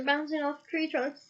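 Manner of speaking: ordinary delivery, nothing marked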